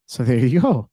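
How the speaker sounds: normal talking voice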